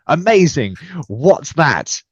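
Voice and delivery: mock thrilled cadet; retold excitement.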